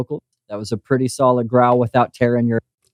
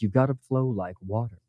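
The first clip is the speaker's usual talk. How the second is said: quoting a line; 2 semitones lower, 9 dB quieter than usual. Retold or imitated. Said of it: "just to quote the lines".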